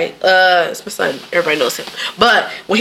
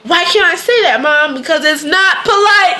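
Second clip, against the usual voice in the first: higher-pitched retold parent-and-child exchange; kid talking to Mom; 9 semitones higher than usual. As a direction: pitch it up, imitating a protesting kid. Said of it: "I tell my kid about".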